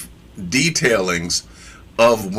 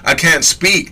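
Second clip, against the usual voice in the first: raised, whiny "I can't speak"; mock a reluctant prophet's complaint, pitched up; whining prophet; 5 semitones higher, 3 dB louder than usual. Preacher imitates Jeremiah sounding like Moses, lightly whiny. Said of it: "It kinda sounds like Moshe".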